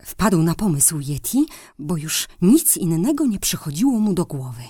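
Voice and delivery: ordinary delivery, nothing marked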